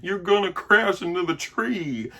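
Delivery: hammed-up kids shouting a warning; mock panic. Kids warning about the crash, mock panic. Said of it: "where they're like".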